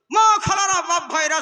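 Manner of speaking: hammed-up stage call opening a fatwa; mocking preacher. Mocking a preacher calling out to the jalsa crowd, high and loud. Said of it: "জালসায় উঠে উঠে ফতোয়া দিয়ে দিল".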